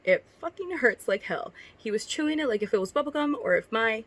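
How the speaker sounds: lightly performed read-aloud of a cringey story; reading fanfic aloud. Acted. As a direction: read it like a story you're embarrassed by. Reads her old fanfic aloud.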